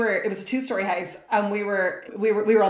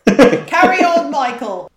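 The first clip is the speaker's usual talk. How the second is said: louder, higher mock Cockney call, drawn out; playful Cockney accent; 5 semitones higher, 9 dB louder, slower than usual. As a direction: ham up a Cockney catchphrase. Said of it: "say that in a Cockney accent".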